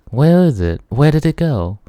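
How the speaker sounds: put-on high, choked little-girl voice; a lost child calling out in tears; frantic little girl. Little girl calling out, high and frantic. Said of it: "their voice sounds breathy and high-pitched".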